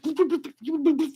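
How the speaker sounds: very high-pitched mock computer beeps; imitated computer boops. Streamer imitating a court computer processing filings, playful high boops. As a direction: rattle off little high robot boops. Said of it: "like, a computer that just".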